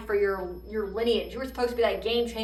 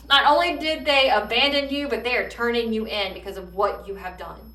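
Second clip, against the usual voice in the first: usual speaking voice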